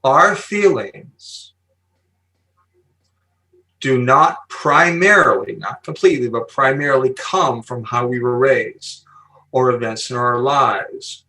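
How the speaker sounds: slow, deliberate delivery with long pauses; measured, drawn-out emphasis on key words; really slow. Real, not acted. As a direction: spell it out slowly so nobody misses it. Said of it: "say this really slowly".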